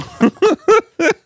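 high squealing laugh. Real, not acted. Laughing at the accent joke.

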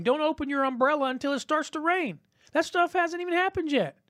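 climbing high and urgent; preacher's voice soaring with urgency; rising fervor. Acted.

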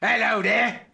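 loud, high British greeting; exaggerated British accent. Shown as a demonstration. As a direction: belt out a hammed-up British hello. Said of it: "do your best British accent".

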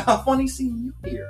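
high mock sweet voice; imitating her. Retelling the park run-in, high mock girly voice. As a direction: squeak it out like a surprised friend. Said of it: "She said".

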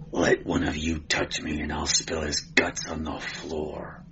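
low, quiet menacing growl; growled threat. A narrator's reading. Cimmerian warning the police, low growl. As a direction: growl the threat under your breath. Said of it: "he growled".

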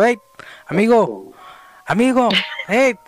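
retold call to someone out of sight. Calling to the hidden man in the room, calling out. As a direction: call after him, pitch climbing by the end.

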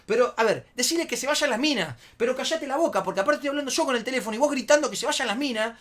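high, loud, exasperated rant; retold worked-up telling-off; heated arguing. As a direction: argue over someone, speeding up at the end. Arguing with shouting friend.

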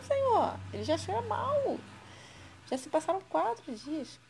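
high, pleading voice of Marta objecting; Marta's protest. Acted.

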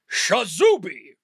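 long-drawn magic word with a swooping middle; magic incantation. Acted. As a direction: call out the spell with a flourish. Wizard's magic word called out.

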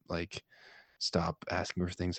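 ordinary delivery

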